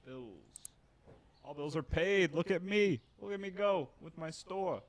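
loud, high gloating over the store; excited boast. Real, not acted. Streamer gloating to chat about paid bills, loud and high.

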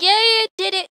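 high, loud burst of triumph; excited relief. Real, not acted. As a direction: cheer out loud that it worked. Game task finally works.

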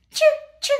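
very high, loud puppet squeak; Osito. Pretend. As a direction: chirp the sound as a toy bear. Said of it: "¿Lo puedes decir, Osito?". (voz muy aguda y fuerte de osito)

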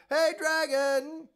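high, loud, drawn-out yell; loud shout. Acted. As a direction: yell right into his ear. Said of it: "she shouted as loud as she could".

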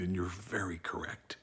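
breathy whisper, secretive; whispered aside. Real, not acted. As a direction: drop to a sly whisper. Coy aside after laugh.

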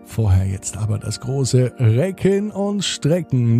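usual speaking voice

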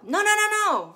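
high, loud parental alarm shout; urgent warning yell. Acted. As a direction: yell to stop a child in danger. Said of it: "need to yell".

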